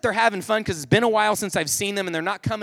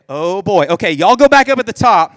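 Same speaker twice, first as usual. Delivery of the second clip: loud, emphatic instructions; retold eager shout; excited dad directing; 3 semitones higher, 9 dB louder than usual. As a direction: call out orders to the kids. Dad orders kids to repeat stunt.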